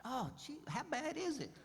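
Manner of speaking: high, soft mock dread; worried thought. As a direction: squeak out a worried inner thought. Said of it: "I'm thinking".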